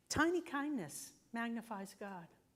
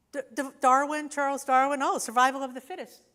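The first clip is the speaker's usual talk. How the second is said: loud, higher, fast mock stammer; mock astonishment; 6 semitones higher, 13 dB louder than usual. Real, not acted. Speaker mocking how everyone quotes Darwin, loudly. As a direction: ham up the shocked know-it-all.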